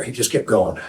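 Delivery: plain reading voice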